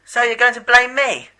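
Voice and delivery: loud, high indignant accusation; angry outburst. Acted. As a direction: blurt it out furious and offended. Accused commander protests angrily.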